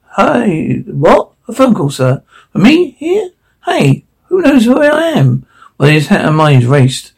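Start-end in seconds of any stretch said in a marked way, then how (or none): 0.1-5.5 high startled questions; surprised. Acted. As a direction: caught off guard, pitch jumping up. Surprised at phone call.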